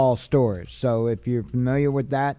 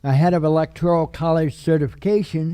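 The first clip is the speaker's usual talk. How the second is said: words stretched by a yawn; 3 semitones higher, 4 dB louder than usual. Real, not acted. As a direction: read it mid-yawn. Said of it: "I don't know why I'm yawning".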